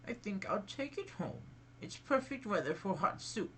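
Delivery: high, lightly voiced old woman.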